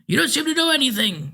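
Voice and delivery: possessed driver insists he is fine, thin and piping. A narrator's reading. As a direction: pipe it out like a frail eighty-year-old. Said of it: "in a thin, piping voice".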